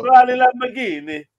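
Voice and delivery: mock Italian with big gestures; fake Italian. Mock Italian word game.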